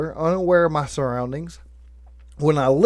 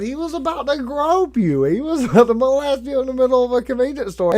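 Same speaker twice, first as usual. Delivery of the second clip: higher, fast teasing through a laugh; laughing mom; 8 semitones higher, 3 dB louder than usual. Retold or imitated. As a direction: let a laugh break into the tease as mom. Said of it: "she's died laughing, going".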